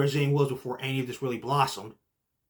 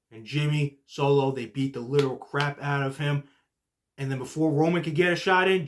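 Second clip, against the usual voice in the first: ordinary talk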